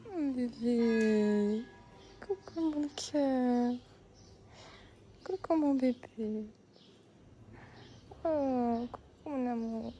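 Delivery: slow drawn-out coos. Real, not acted. Greeting the cat Johanna, slow cooing baby talk. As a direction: croon to a beloved pet.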